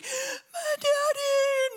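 high, slow, drawn-out wail; imitated child crying. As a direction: mimic a six-year-old crying for her dad. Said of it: "and she's crying".

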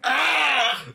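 sudden high startled Ah; panicked high cry; high yelp of alarm. Retold or imitated. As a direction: yelp as the kid's head hits. Kid hits chandelier.

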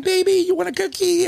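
high coaxing call to a dog; high-pitched baby-talk call to a lost dog; high sing-song call. Retold or imitated. Calling the dog out of marsh.

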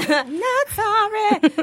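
high, drawn-out teasing echo; playfully unrepentant. Pretend. Echoing the friend's teasing, playfully unrepentant. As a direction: stretch it out slowly and playfully.

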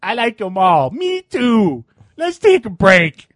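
high, drawn-out geek voice; hammed-up cartoon nerd. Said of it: "Highlanders' nerd voices are pretty good".

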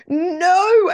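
high, loud wail of no; anguished cry. Retold or imitated. Yelling no as the puppy chews the banana, anguished.

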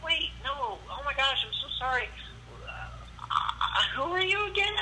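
high-pitched, flustered girl imitation; apologetic girl. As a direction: apologize in a mock girly voice, stumbling. Imitating Jenny apologizing in confusion.

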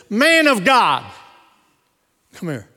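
calling out. Retold or imitated. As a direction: hail someone across the room. Prophet calls out from pulpit.

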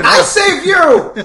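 very high, loud, drawn-out protest; indignant mock yell. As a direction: shout it in hammed-up outrage. Mock quarrel over who saved whom.

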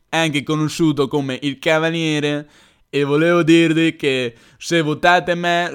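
exaggerated politician promising to cut taxes; Berlusconi impression. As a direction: Silvio Berlusconi parody on a campaign stump. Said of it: "Questa è la mia imitazione di Berlusconi".

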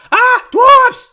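very high, loud goblin shriek; goblin alarm cry. Acted. Goblin spotting dwarves dashing through, high alarmed shout. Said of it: "sees you and goes like".

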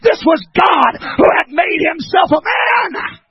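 shouted, high-pitched pulpit climax; roaring, stretched-out sermon crescendo; full-force preaching. Real, not acted. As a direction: hammer out each phrase at the top of your voice.

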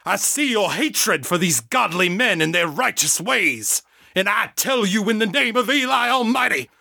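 high, rising hellfire preacher voice; fiery exorcist preaching. A narrator's reading. John casting out Janet's demon, fiery preaching. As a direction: build the rebuke to a peak.

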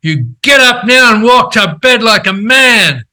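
stern command. Retold or imitated. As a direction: snap him out of it, no arguing. She's a really quiet person.

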